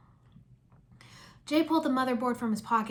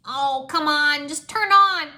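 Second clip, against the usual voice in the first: higher, loud, slow begging at the machine; frustrated pleading; 5 semitones higher, 10 dB louder, much slower than usual. Acted. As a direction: plead with the dead machine, loudly. Jay frustrated with broken game.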